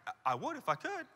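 very soft, halting reply; depressed person. Acted. Depressed person replying to pushy advice, quietly defeated. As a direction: answer small and defeated. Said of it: "a depressed person".

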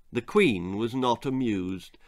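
ordinary delivery, nothing marked

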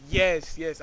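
loud, excited retold cheer; punchy, happy outburst; excited celebration. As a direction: relive the win with a loud yes. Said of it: "just going crazy".

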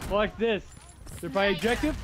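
ordinary talk, nothing marked

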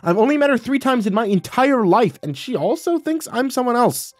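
high, incredulous protest; exasperated. Acted. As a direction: build into squeaky exasperation. Son's exasperation builds at mom.

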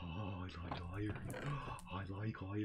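soft, low murmur between gasps; gasp, then softly savour it; hushed delight. Pretend.

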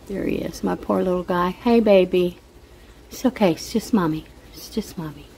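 calm him down with a hushed voice; soothing the cat. Real, not acted.

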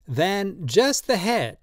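put-on high, slow, pleading old man's voice; old tattooist Drioli, drunk and pleading. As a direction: coax him tenderly, in wine.

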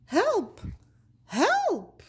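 high, desperate calls; cry for help. Acted. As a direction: call out as if falling. Character cries for help.

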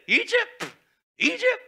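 Egypt? thrown up very high and loud; mocking disbelief, shouted high; incredulous scoff.

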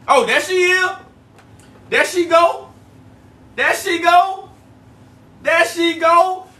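high, loud excited welcome as she joins; calling out happily, voice jumping up; excited greeting. Real, not acted. Host greeting birthday girl joining the live, excited and loud.

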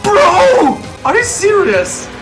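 very high, loud incredulous outburst; high stretched protest; shocked disbelief. Real, not acted. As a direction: cry out in disbelief, pitch shooting up. Sudden reaction in game.

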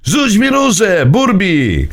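high urgent call; shouting. A narrator's reading. Niesforek shouts to Burbi to throw the rose, high. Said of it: "krzyknął niesforek".

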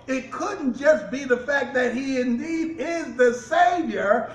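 fiery preaching, some shouted lines. Acted. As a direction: preach it hard to the congregation. Preaching about the Pharisees.

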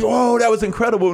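mock amazement. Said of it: "No, we cried".

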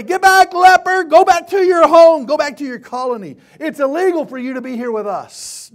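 high, very loud acted shout; preacher's hammed-up banishing yell; mock angry rejection. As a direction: drive the leper away at full volume. Imagined Jesus driving off a leper.

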